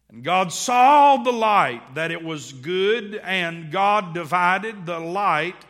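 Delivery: slow, loud solemn Bible reading; scripture reading. Acted. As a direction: read it out like holy writ. Reads Genesis aloud dramatically.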